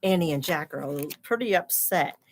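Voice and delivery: normal talking voice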